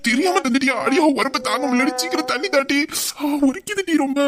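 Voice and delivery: high, complaining whine; hammed-up chilli agony; pained wailing. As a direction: beg for water as if burning. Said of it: "கத்தாத".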